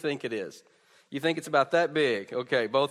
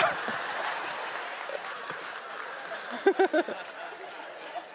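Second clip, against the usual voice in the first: airy laughter, pitch falling; breathy high laugh; 9 semitones higher, 6 dB quieter than usual. Real, not acted. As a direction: let out a soft breathy laugh. Kids amuse the congregation.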